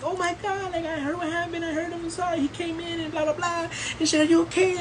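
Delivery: high, soft imitated woman's voice; hushed, high-pitched mock concern; concerned sister. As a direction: imitate a worried young woman, light and high.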